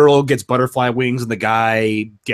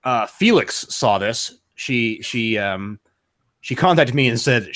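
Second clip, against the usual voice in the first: ordinary delivery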